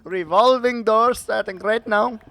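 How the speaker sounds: excitable Indian accent, rising high and loud; hammed-up Indian accent rant, climbing in pitch; attempted Indian accent. Shown as a demonstration. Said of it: "that's as best as I got".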